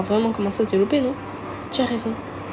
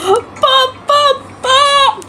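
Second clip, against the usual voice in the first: very high, loud crying out; mock wail; 6 dB louder, much slower than usual. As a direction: wail like a hurt child. Natsuki's dramatic cry. (Natsuki qui pleure papa, très aigu)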